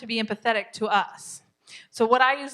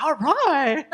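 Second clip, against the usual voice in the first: loud, higher mock-kid voice; kid's reply; 5 semitones higher, 6 dB louder than usual. Kid reacting to mom's huge punishment, loud mock reply. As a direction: answer as the kid, pitched up and hammed-up. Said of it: "and the kid's like".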